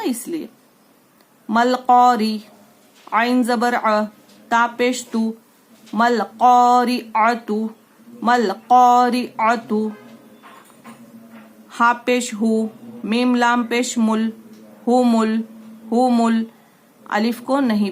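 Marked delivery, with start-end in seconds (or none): 1.5-2.4 री in कौरी said light and thin. Shown as a demonstration. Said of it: "रॉ बारीक पढ़ेंगे". 3.1-16.4 syllable drill. Shown as a demonstration. Spelling out syllables for class.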